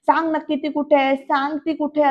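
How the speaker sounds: usual speaking voice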